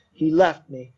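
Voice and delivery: usual speaking voice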